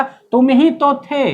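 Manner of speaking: ही punched high and loud; stress on ही. Shown as a demonstration. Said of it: "किस पर pressure डाला गया". ("ही" पर ऊँचा, ज़ोरदार बल)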